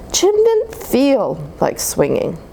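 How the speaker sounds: plain reading voice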